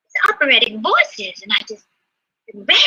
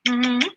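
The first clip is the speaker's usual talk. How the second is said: blown kiss; 3 dB quieter than usual. Real, not acted. To her viewers at sign-off, a smacking blown kiss. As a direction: blow a big kiss to the camera.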